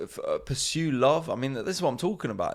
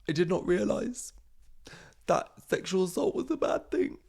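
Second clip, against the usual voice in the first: mock tearful celebrity apology, pitched way up; fake apology; 9 semitones higher than usual. Comic mocking a celebrity's staged apology, pitched high. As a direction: ham up a phony public apology. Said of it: "do, like, a fake apology".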